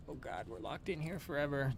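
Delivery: small, very soft voice; hushed mock despair. Pretending to be locked in forever, hushed. Acted panic about being locked in.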